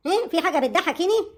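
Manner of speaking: ordinary talk, nothing marked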